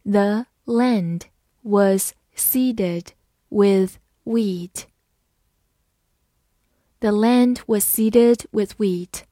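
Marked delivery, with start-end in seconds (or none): 0.0-4.8 very slow, word by word; teacher reading slowly. Shown as a demonstration. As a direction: draw the sentence out for learners. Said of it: "好，慢读一遍".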